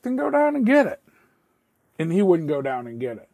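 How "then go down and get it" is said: much higher and louder, snapping the order; fed up, push him to go get it now; teen yelling at a kid who won't pay up; angry demand. Retold or imitated. Demanding the bet money.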